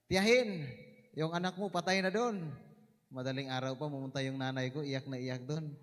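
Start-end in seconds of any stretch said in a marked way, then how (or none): 0.1-2.5 calling out. Retold or imitated. Relative calls out to mother.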